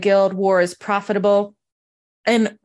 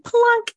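plunk sound; 13 semitones higher than usual. Retold or imitated. Emerald dropped with each compliment.

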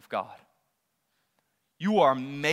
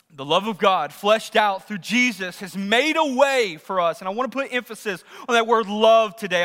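higher, driven preacher's voice; full-force preaching; 9 semitones higher, 10 dB louder than usual. Acted. Preacher asking the congregation what homes are built on, full-force preaching. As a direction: preach it hard, hammering the key words.

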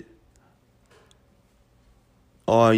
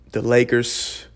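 usual speaking voice

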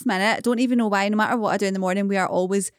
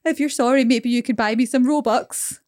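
put-on cheeky child; 3 semitones higher than usual. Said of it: "And he went".

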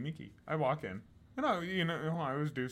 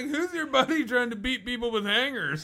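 high, loud mocking voice; guys asking, high pitched; 10 semitones higher, 7 dB louder than usual. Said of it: "They're like".

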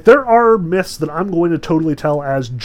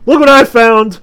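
much higher, louder mocking call; gleeful show-off; 9 semitones higher, 13 dB louder than usual. Hephaestus parading the caught lovers before the gods, gleeful loud call. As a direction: crow it out, showing off his catch to everyone. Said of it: "and he's like".

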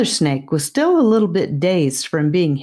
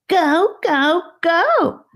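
much higher, teasing sing-song; taunting high snake; 13 semitones higher, much slower than usual. A narrator's reading. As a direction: taunt the child in a squeaky voice. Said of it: "the snake taunted again".

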